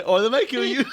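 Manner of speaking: high flustered dad voice; a dad blurting it out in a panic; panicky dad. Retold or imitated. Repeats punchline.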